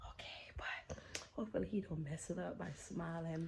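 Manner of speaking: hushed secret whisper. Real, not acted. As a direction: whisper it under your breath. Whispering during prank setup.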